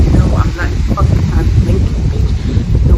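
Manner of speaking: loud, cutting no; angry refusal. Retold or imitated. Refusing the boy who keeps asking, loud and angry. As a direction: slam the door on him loudly.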